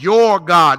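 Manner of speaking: Your hit loud and long; loud, weighty emphasis on "Your"; stress on YOUR. Shown as a demonstration. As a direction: punch "Your" to mark possession. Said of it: "That's possessive pronouns he's using".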